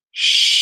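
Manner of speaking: loud, long hushing exhale; healing shh breath. Shown as a demonstration. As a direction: push the breath out as a hush. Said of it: "breathing to the left".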